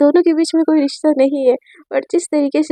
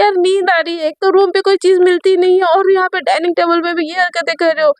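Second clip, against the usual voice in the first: higher, put-on teasing girlfriend voice; pouting girlfriend voice, higher pitched; Sejal's voice; 4 semitones higher than usual. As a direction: tease her in a higher sulky voice. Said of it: "तो संजय बोलती है".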